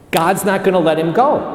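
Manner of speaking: ordinary delivery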